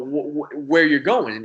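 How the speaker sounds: normal talking voice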